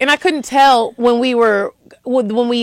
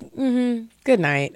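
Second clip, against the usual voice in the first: soft, dropping dismissal; quiet, curt goodbye; retold brush-off; 3 semitones higher, 7 dB quieter, much slower than usual.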